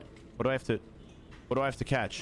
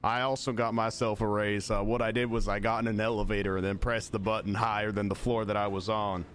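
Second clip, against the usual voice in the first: put-on polite Southern accent, deadpan; country Southern accent. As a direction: play a courteous Southern country fellow. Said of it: "I'm stuck in this accent now".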